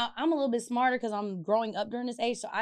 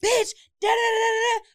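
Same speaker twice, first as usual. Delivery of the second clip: much higher and louder, cursing him out; furious yell; 12 semitones higher, 6 dB louder than usual. Retold or imitated. To the boyfriend while keying his car, a furious yell. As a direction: scream abuse at him.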